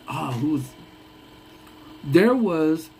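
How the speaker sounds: ordinary talk, nothing marked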